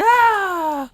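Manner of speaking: high, loud yelp of pain; pained scream. Acted. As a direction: cry out like you've been hit. Acting a shot soldier dummy.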